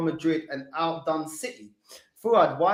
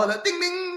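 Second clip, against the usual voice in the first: bell imitation; 13 semitones higher than usual.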